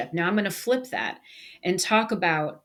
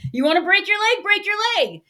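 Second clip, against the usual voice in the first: higher, louder imitation of a Sicilian accent; 13 semitones higher, 3 dB louder, faster than usual. Server quoting his Sicilian mom, lively Sicilian accent. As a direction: channel a Sicilian mother in a Sicilian accent. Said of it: "in this fantastic Sicilian accent".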